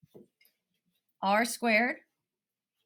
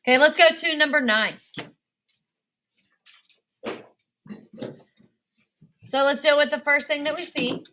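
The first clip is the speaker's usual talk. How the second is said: shouting over fire alarm; 3 semitones higher, 4 dB louder, faster than usual. Real, not acted. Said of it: "Sorry about the fire alarm".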